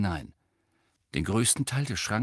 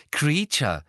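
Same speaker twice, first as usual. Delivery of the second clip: high, proud one-word answer; beaming; 6 semitones higher, 5 dB louder than usual. A narrator's reading. Hermine tells Ron whom the gift is for, beaming. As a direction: announce it happily, lifted and louder. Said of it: "sagte Hermine strahlend".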